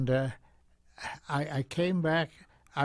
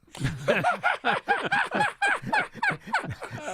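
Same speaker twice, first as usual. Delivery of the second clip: high, falling laugh; burst of laughter; 4 dB louder than usual. Real, not acted. Recalling Harry's complaint to the interviewer, laughing. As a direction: laugh out at the memory.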